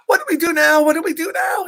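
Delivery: high, panicky hollering; hollering duck. A narrator's reading. Duck hollers at goose in a panic, high yell. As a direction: holler it in a flap. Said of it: "he ho-, hollered".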